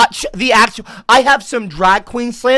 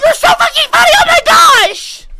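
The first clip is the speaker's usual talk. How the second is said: very high, loud mock delight; sarcastic hysterics; 6 dB louder than usual. Streamer mocking Joe's comedy, sarcastic shrieking. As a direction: scream fake praise as if overcome. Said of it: "You're not fucking funny at all".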